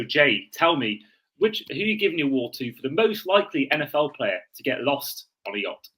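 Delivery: ordinary talk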